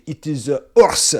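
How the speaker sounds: put-on French snob in accented English. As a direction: play a snobby Frenchman objecting, halting before the last word. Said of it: "that's my French snob voice".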